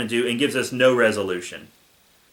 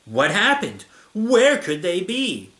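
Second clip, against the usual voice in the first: hammed-up, high anxious questions; mock worry; 7 semitones higher, 4 dB louder, slower than usual. A narrator's reading. Diary girl can't find the Godzillas, mock worry. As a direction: overplay the worry, stretching the question. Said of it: "so I began to get worried".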